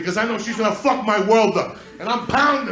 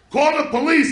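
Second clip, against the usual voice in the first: high, raised-voice cry for help; mock panicked call, pitched up; imitated yelling; 6 semitones higher, 4 dB louder than usual. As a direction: yell it out like an alarmed onlooker. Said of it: "They're yelling".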